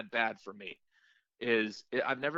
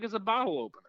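higher-pitched mock innocence; sheepish excuse; 10 semitones higher, much faster than usual. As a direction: offer it as a feeble cover story. Retold sheepish excuse to security.

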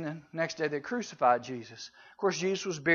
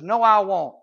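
louder, mildly defiant comeback; defiant retort; 2 semitones higher, 8 dB louder than usual. Acted. Simon Peter contradicting Jesus' prediction, defiant retort. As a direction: push back firmly and louder.

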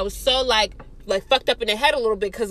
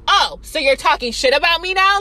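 loud, raised, accusing challenge; angry confrontation; 6 semitones higher, 6 dB louder than usual. Retold or imitated. Teller turning on a friend caught talking about her, loud accusing retort. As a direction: confront them, retelling it with force.

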